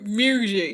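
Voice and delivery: "music" in an imitated Memphis accent. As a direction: mimic a Memphis rapper's "music". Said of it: "that's how she says it".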